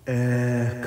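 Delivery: word ringing with echo; echo effect. Shown as a demonstration. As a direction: say it into a reverb. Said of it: "You can even add echo".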